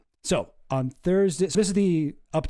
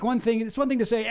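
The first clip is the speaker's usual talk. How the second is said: normal talking voice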